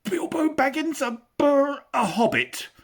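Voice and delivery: very high, loud frightened stammer; terrified hobbit. Acted. Bilbo answers the trolls, terrified and stammering. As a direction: squeak it out, shaking with fear. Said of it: "shaking all over".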